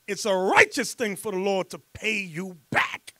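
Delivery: ordinary delivery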